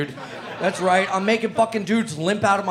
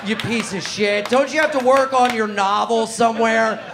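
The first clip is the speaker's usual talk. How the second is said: normal talking voice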